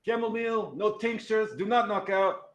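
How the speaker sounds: high, loud North Country holler; little high-pitched cook calling out; tiny yelling kitchen voice. Acted. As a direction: yell the order as a tiny voice from the kitchen. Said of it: "this tiny little voice from the kitchen, North Country voice, yelling".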